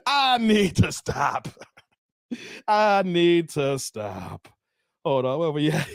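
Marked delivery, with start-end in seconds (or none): 0.1-4.5 very slow, drawn-out words; slow self-admonishment. Real, not acted. Host coming down from laughing, very slow self-scolding. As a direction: stretch every word while winding down.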